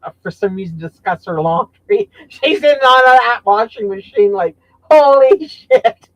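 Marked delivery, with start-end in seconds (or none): 4.9-6.1 mock amazement. Laughing quote about sister.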